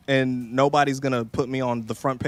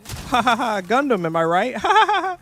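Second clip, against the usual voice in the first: higher, laughing at her own joke; mock hammy joke teller; 8 semitones higher, 4 dB louder than usual. She mocks herself telling a Gundam joke to a silent chat. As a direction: ham up a comedian begging for laughs.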